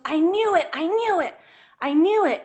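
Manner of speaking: high, loud retold cry of realization; a mother blurting out what she feared; retold outburst. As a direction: replay her sudden alarmed realization.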